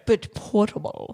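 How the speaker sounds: self-satisfied snob voice; tech snob voice. Acted. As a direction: say it like a know-it-all tech snob. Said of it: "That was my tech snob voice".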